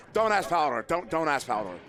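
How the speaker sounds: ordinary talk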